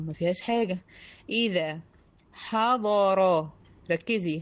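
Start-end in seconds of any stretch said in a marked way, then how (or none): 2.3-3.5 حضر with light ح and heavy ض and ر, drawn out; tajweed demo. Shown as a demonstration. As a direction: stretch حضر, thin ح, heavy ضر. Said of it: "الحاء هنا مرققة والضاد والراء مفخمين". (حضر بحاء مرققة وضاد وراء مفخمين)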